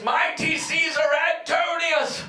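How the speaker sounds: slow, high, half-sung plea; pleading gladiator. Acted. Beaten student begs Caesar for his payment, slow and sung. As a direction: beg the emperor grandly, stretching it out. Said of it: "he looks up to the dais and he says".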